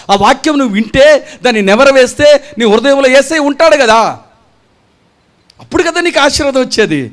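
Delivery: rising sermon fervour; fervent preaching. Acted. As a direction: drive the point home to the congregation. Preaching at full force.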